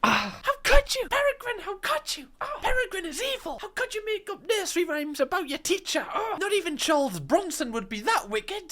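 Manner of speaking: high, hammed-up scandalised gasping; over-the-top mock horror at a naughty kid; mock outrage. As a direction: play it like a shocked aunt clutching pearls. Mock outrage at cartoon child.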